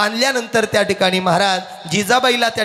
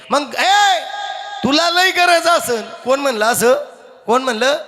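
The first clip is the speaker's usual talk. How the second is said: indignant retort; 4 semitones higher than usual. Acted. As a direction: tell him off, stretching the words high. Jijabai देवाला सुनावते. (चिडून, लांबवत चढ्या आवाजात)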